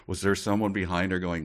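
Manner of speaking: normal talking voice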